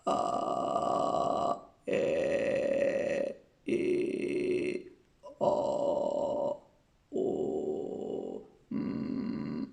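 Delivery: very low creaky fry on open vowels; vocal fry demo on vowels. Shown as a demonstration. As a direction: let the voice crackle slow and loose at the bottom. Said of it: "Okay, one more time".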